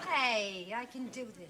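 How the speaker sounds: low macho guy voice; imitating Jack. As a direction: puff up like a cocky ladies' man. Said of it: "Why don't you be Jack and show us?".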